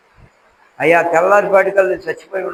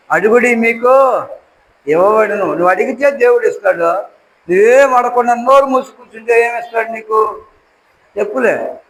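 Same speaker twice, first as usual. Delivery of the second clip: higher, louder impassioned sermon; fiery preaching; 5 semitones higher, 4 dB louder than usual. Acted. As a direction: preach hard to rouse the church. Preaching at full force. (ఉద్వేగంతో గట్టిగా బోధించడం)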